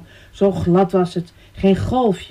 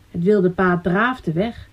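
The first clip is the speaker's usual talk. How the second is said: plain reading voice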